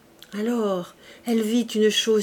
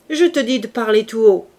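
loud, insistent command; angry order; 3 semitones higher, 8 dB louder than usual. A narrator's reading. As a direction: insist that he speak up. Javert repeats his order.

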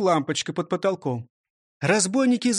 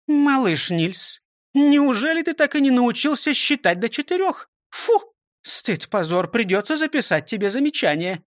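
stern teacher; 8 semitones higher, 3 dB louder than usual. A narrator's reading. Teacher scolding.

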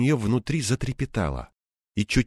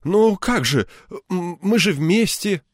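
higher, halting protest; flustered, stammering, raised pitch; flustered hesitation; 9 semitones higher, slower than usual. A narrator's reading. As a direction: stumble over the words while protesting. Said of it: "Он замялся".

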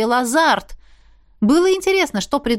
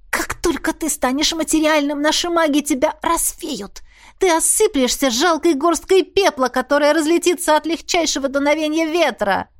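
defiant heroine; 5 semitones higher than usual. A narrator's reading. Heroine defiant under choking.

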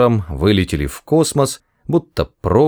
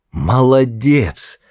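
slow drawn-out praise; encouraging; 4 semitones higher, much slower than usual. Acted. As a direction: praise it slowly. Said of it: "поощрил Форд".

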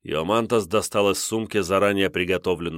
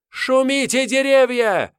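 very high, loud ecstatic cry; witch incantation; 6 dB louder than usual. Acted. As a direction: summon the trees in triumph. Witch calls to the forest.

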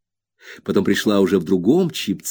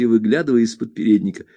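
usual speaking voice

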